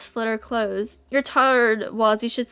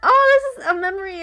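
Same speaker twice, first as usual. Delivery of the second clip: sudden realisation; 6 semitones higher than usual. Real, not acted. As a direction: catch on suddenly. Realising a memory.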